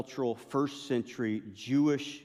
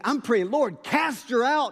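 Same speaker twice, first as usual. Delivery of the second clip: high, loud, hammed-up plea; fervent mock prayer; 12 semitones higher, 7 dB louder, slower than usual. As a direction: cry out to heaven as if casting out a demon. Retold frantic prayer against her.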